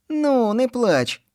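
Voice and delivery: put-on high, slow boy's voice, soothing; slow down and comfort her. A narrator's reading. Ivas comforting Manyunya, slow and soothing. Boy comforts crying girl.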